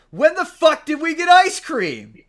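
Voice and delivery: high, loud, stretched disbelieving yell; incredulous shout. Retold or imitated. Friend at the movies yells at the fiance in disbelief. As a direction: blurt it out in shocked disbelief. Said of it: "one of the guys goes".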